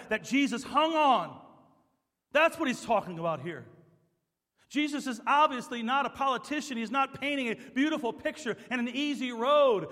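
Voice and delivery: building sermon fervor; full-force preaching. Acted. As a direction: drive the point home hard. Preaching builds to loud peak.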